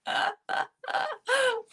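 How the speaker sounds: real, high-pitched laughing fit; high burst of laughter. Real, not acted. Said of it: "here's the laugh for the evening".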